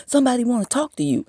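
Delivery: high, fast, put-on pushy voice; mocking Tansy. Imitating Tansy shoving the phone at her, high and fast. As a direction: rush it out in a shrill-sounding mimic.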